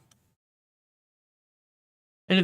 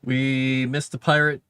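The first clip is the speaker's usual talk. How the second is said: ordinary delivery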